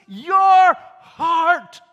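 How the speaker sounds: loud, high, forceful reply; Jesus answering. Acted. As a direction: declare it with full force.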